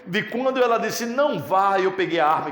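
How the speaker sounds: high, loud pulpit delivery; impassioned preaching. Real, not acted. Preacher testifying to the congregation about his mother, impassioned preaching.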